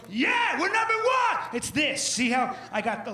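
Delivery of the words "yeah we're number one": high, loud sports yell; stadium cheer. Retold or imitated. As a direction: cheer your team like a fan. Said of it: "or what".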